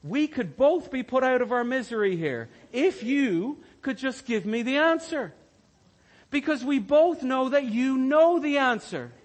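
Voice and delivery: loud pleading inner voice; begging for the answer. Retold or imitated. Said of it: "I look at her and I think".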